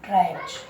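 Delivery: low "ट्राइब्स" with a j ending; final J sound. Shown as a demonstration. Teacher answering own question to class, final J sound. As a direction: say "ट्राइब्स" as traib-j. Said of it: "क्या बोलेंगे?".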